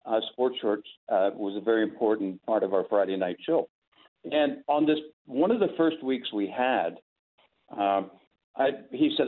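usual speaking voice

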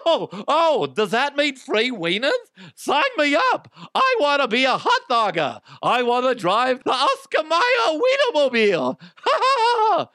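high, loud, giddy squeal; squealing excitement. Pretend. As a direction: squeal like an overexcited fan. Excited fake job applicant squeal.

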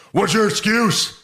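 barking drill instructor, high and loud; drill sergeant. Acted. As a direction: bark it like a furious sergeant. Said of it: "I'll be Stone Cold as Hartman".

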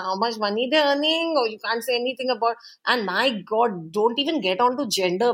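ordinary talk

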